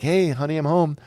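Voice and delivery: mock-happy I'm home call; cheery homecoming greeting. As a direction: breeze in calling to the wife. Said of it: "I'd be like".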